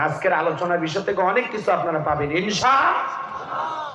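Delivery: normal talking voice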